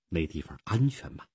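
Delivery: plain reading voice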